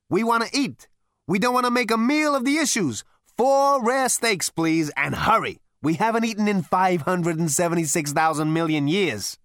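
hungry alien ordering steaks, impatient; impatient Zaphod. A narrator's reading. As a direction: bark the order, starving. Zaphod speaks.

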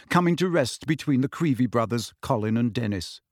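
ordinary delivery

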